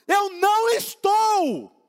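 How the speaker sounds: shouting that he is not angry; mock angry shout. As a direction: burst out shouting the denial. Acted argument, anger bursts out.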